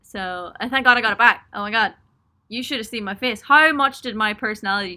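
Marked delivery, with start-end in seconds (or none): none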